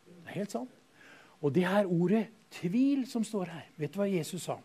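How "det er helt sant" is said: hushed affirmation. Acted. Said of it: "Er det sånn, sier du?".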